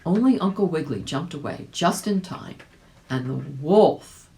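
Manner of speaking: ordinary delivery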